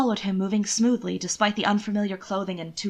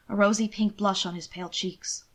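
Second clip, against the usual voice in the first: usual speaking voice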